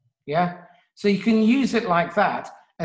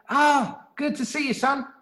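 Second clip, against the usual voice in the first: bright and higher, friendly greeting; cheerful parent; 6 semitones higher than usual. Shown as a demonstration. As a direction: greet the son lightly, pitched up.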